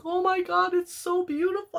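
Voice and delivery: high squealing rapture; excited squeal. Retold or imitated. Retelling her thrilled reaction to the fixed frame, high squeal. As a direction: squeal it like you just opened it. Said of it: "I was so excited".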